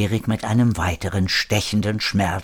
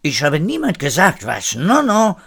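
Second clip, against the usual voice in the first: higher, protesting self-justification; protest, rising on the denials; defensive; 6 semitones higher than usual. A narrator's reading. Frenchman justifying himself to Marina, defensive. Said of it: "rechtfertigte sich René".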